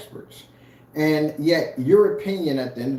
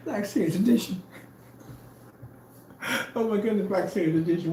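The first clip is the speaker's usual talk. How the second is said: soft, higher, swooning; mock dreamy sigh; 6 semitones higher, 7 dB quieter than usual. Mocking rivals dreaming of his paint at night, soft swoon. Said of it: "Be in the bed like".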